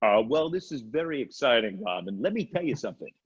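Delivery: radio host impersonation with swooping pitch; put-on radio-host voice, pitch dipping and jumping; Howard Stern. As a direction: do an impression of a radio host talking to a co-host. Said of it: "You've seen my Stern".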